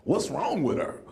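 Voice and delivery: normal talking voice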